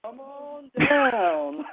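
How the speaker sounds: high, slow game show call; game show host. Pretend.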